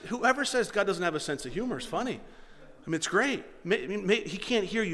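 ordinary talk, nothing marked

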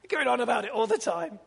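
lightly voiced insistent bishop; high urging call; Bishop Sadir. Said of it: "I can't do his Indian accent".

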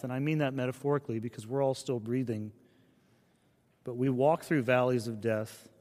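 usual speaking voice

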